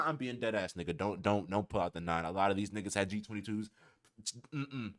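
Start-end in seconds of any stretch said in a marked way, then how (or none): none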